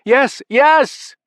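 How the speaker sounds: high, loud frantic answer; hollering. A narrator's reading. Sons holler back to furious father, frightened shout. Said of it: "they hollered back".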